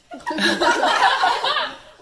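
high helpless laughing fit; loud burst of laughter. Real, not acted. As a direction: crack up loudly mid-read. Reader breaks up laughing.

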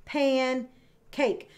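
pancake with a pause between pan and cake; pan, then cake, pancake pulled apart; split into syllables. Shown as a demonstration. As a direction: tap out pancake in two beats. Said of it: "Do it again with me".

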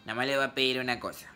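a lightly nasal principal over the microphone; nasal school director. Retold or imitated. Principal addressing pupils in line, lightly nasal voice. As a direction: mimic the director's nasal announcement at a school assembly. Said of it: "el director hablaba medio gangosillo".

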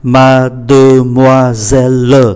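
slow "Mademoiselle", sounding both e's; five syllables. Shown as a demonstration. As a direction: draw out "Mademoiselle" syllable by syllable, ma-de-moi-sel-le. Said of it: "Như vậy có tất cả là năm syllab". ("Mademoiselle" đọc chậm đủ năm âm tiết)

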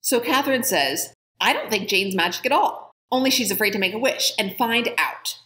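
put-on reading a children's book aloud, lively and clear; story narration. A narrator's reading. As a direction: tell it like a bedtime chapter to listeners. Reads novel aloud.

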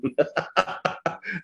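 light chuckle at himself; amused, self-mocking laugh. Real, not acted. Laughs at own pious claim. (tertawa geli)

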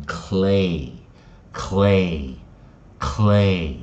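clay blended from "cl" and long "ay", slowly stretched; blended sounds. Shown as a demonstration. Teacher blending onset and rime, clay drawn out slowly. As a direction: say clay slowly three times, holding the vowel. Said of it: "所以是不是就变成clay".

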